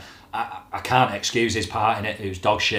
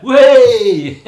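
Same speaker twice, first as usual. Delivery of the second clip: loud, high laughing shout; real laughing whoop; 11 semitones higher, 11 dB louder than usual. Real, not acted. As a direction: burst out laughing at the punchline. Laughing at own joke.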